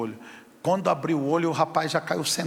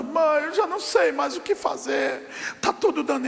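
crying daughter; 8 semitones higher than usual. Retold or imitated. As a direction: plead to mom through tears, voice raised. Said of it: "ela chorando". (filha chorando, suplicando alto à mãe)

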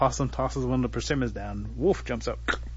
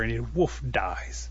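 ordinary delivery

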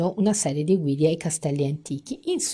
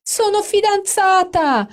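much higher, louder, slow squeal; gushing announcement; 7 dB louder, slower than usual. Acted. Amanda announcing her engagement to her friends. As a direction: savour the big news.

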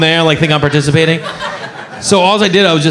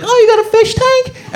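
very high, gushing mock delight; impressed guest. Visitor spotting a fish tank, high mock-impressed voice. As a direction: coo high in hammed-up wonder.

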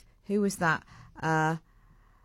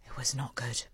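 whisper; 4 semitones lower, 8 dB quieter than usual. Real, not acted. Confiding a bad movie verdict.

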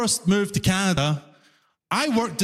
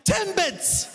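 high, clipped customer voice; mock impatient customer repeating herself; 10 semitones higher than usual. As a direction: say it like it's obvious. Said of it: "She's like".

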